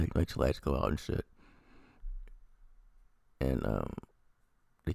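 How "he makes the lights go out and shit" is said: ordinary delivery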